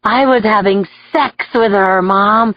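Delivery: ordinary delivery, nothing marked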